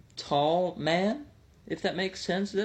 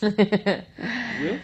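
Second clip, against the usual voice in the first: stretched-out slow; 4 semitones higher, 4 dB louder than usual. Pretend. Teasing about fancy hats.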